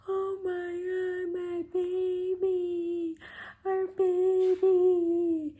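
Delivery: very high and slow, overwhelmed, rising to loud; tearful awe. Retold or imitated. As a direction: melt over the newborn, drawing it out. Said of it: "and I'm like".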